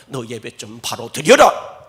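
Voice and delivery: a loud, slow stern rebuke; God's rebuke. Acted. God rebuking worshippers, loud and stern. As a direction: scold them from the judgment seat. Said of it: "하나님이 판결대 앞에 서서".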